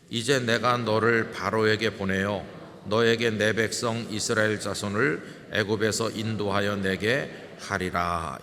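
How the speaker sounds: loud, measured unison reading; scripture reading. Acted. Pastor leading the church in reading scripture, firm and loud. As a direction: read it out together, slow and firm. Congregation reads scripture aloud.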